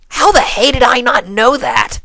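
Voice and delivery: high, loud stunned retort; shocked outburst. A narrator's reading. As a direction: blurt it in disbelief. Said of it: "Rainbow shot back".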